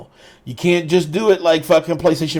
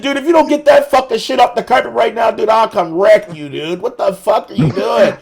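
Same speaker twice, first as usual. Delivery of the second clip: retold furious threat, raised pitch; losing it; 6 semitones higher, 4 dB louder than usual. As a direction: chew out a friend, as retold. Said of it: "I fucking lost it".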